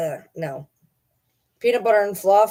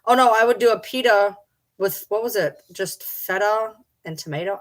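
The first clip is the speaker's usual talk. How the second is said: ordinary delivery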